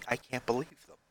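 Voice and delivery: ordinary delivery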